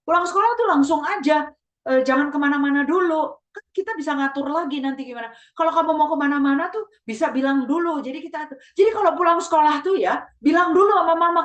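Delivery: high-pitched, insistent parental lecture; long-winded nagging mother. Acted. Mother scolding child for wandering after school, high and nagging. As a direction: nag on and on, pitched well up. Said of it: "orang tua yang ngomongnya kepanjangan".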